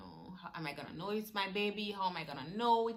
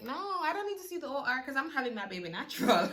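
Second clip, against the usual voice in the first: mock breezy confidence; 7 semitones higher, 4 dB louder, faster than usual. Retelling her confident refusal.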